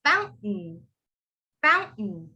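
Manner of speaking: "fountain" as foun, catch, "en"; silent T. Shown as a demonstration. Teacher helps students with fountain, silent T. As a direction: end "fountain" on N, no T. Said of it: "you might say N".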